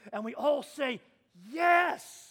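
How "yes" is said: loud, higher acted cheer; emphatic assent. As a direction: cry out for the whole congregation. Voices congregation's joyful assent.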